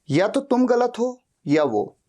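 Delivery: ordinary talk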